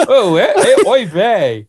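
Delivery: put-on slow, laughing oy vey in Yiddish accent. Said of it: "you'd make a good New Yorker".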